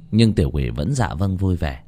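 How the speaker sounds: ordinary delivery, nothing marked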